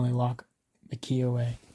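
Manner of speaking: usual speaking voice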